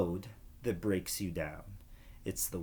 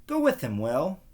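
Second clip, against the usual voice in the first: a hint of a brisk dad, pitched up; dad's voice; 5 semitones higher, 4 dB louder than usual. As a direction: lightly voice the father urging his son. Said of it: "Dad overheard".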